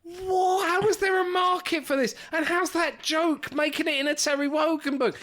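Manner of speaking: very high-pitched, astonished questioning; real incredulity. Real, not acted. Co-host aghast at joke in a Terry Wogan book, incredulous. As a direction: demand an explanation, pitched right up.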